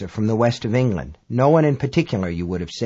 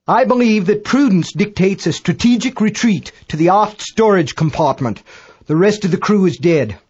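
high, formal astronomer under pressure; Dagbolt reporting; 6 semitones higher, 4 dB louder than usual. A narrator's reading. British astronomer reports retreat and dead crew, high urgent voice. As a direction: announce the retreat in a high, raised voice.

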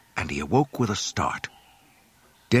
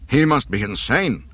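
loud, higher incredulous cry; 5 semitones higher, 6 dB louder than usual. A narrator's reading. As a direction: call out in alarmed disbelief. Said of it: "Captain Needa cried".

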